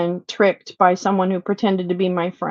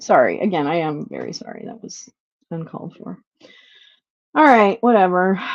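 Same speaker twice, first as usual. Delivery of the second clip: normal talking voice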